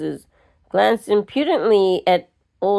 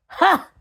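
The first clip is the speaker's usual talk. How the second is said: snort; 9 semitones higher than usual. A narrator's reading. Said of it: "snorted Missus Carraway".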